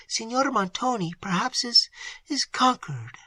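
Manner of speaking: high, soft hesitant guess; faltering. A narrator's reading. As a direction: stumble over a dreadful thought. Emily falters.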